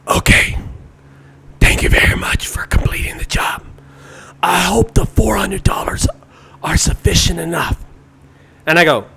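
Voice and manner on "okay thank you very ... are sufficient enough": imitated customer speaking in a whisper; whispered phone voice of the mysterious client. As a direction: whisper it like a secretive caller.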